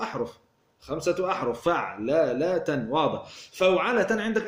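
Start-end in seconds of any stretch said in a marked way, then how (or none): 1.6-2.9 very slow, segmented فعللة; syllable by syllable. Shown as a demonstration. Teacher counting out five letters for students, syllable by syllable.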